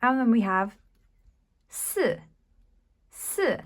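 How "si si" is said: "si" starting high and falling; fourth tone si. Shown as a demonstration. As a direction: drop "si" down from high. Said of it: "which is number four".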